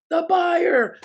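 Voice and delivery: high, loud called-out answer; crowd answer. Retold or imitated. Audience shouts back at Darren, loud call.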